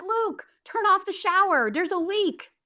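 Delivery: high, urgent yell to her son; urgent call upstairs. Retold or imitated. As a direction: call out to him in alarm, pitched high. Calling son about a leak.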